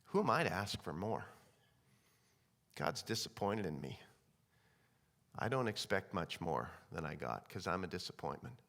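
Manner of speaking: low, soft, defeated murmur; subdued self-reproach, sinking very low; dejected. Acted. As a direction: voice a believer who feels unworthy. Voicing a believer who feels unworthy.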